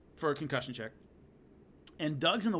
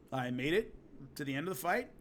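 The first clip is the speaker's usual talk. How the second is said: usual speaking voice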